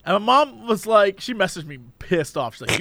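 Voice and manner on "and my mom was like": usual speaking voice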